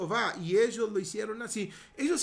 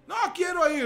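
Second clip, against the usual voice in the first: put-on whiny child; 8 semitones higher, 5 dB louder than usual. Hijo se queja.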